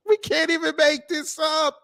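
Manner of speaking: cracking up that nobody could invent this; incredulous laugh. Real, not acted. Laughing at the flop.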